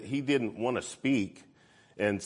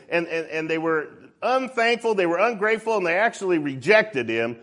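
loud, high-pitched pulpit delivery; raised preacher's voice; full-force preaching; 9 semitones higher, 8 dB louder than usual. Real, not acted. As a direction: preach it hard, driving the point home.

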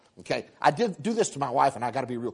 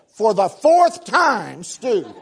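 much louder, very slow, drawn-out irritation; exasperated retort; 4 semitones higher, 7 dB louder, much slower than usual. Retold or imitated. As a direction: spell it out to a man who won't listen. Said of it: "She said".

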